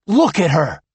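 urgent. Acted. Finn urges.

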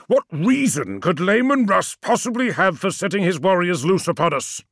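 indignant disbelief; angry challenge. Acted. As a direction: demand the reason heatedly. Magnus challenges Ammon angrily.

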